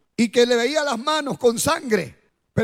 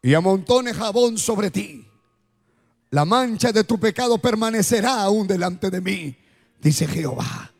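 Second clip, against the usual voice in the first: altar call; 5 semitones lower than usual. Acted. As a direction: invite them gently, eyes closed. Altar call over music.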